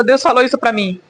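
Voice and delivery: ordinary talk, nothing marked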